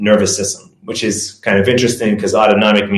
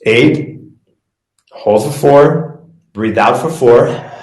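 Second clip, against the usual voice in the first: slow counted cues for breathing along; guided breathing count; 3 semitones higher, slower than usual. Shown as a demonstration. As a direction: lead the class through each breath. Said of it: "I want you to do it with me right now".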